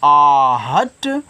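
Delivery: आहट with the आ held very long; stretched आ. Shown as a demonstration. Teacher to children, stretching the long आ. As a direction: draw out the आ in आहट. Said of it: "आ को जोर से बोलो".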